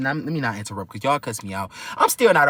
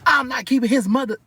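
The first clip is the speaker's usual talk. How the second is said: going off; 9 semitones higher than usual. Retold or imitated. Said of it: "just going off my apartment".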